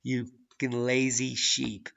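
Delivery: usual speaking voice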